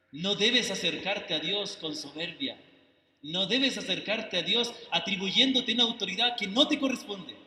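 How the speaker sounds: usual speaking voice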